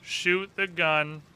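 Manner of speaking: usual speaking voice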